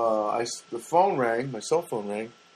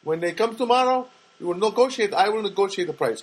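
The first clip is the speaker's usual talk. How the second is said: high, confident promise, imitating a friend; mock-reassuring friend, pitched up; confident Janet; 9 semitones higher than usual. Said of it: "and Janet goes".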